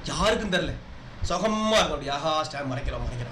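low, hushed voice to oneself; mock private relish, soft and low; secret self-satisfaction. Voices a stammer-hider privately savouring it.

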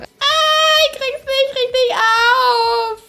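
high, loud, slow squeal broken by laughter; giddy with anticipation; excited squealing. Real, not acted. Streamer fighting the packaging open, laughing and squealing.

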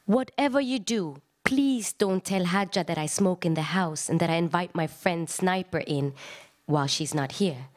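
normal talking voice

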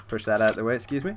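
ordinary talk

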